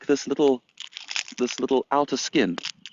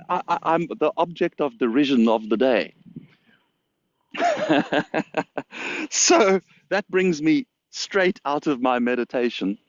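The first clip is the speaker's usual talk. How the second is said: ordinary delivery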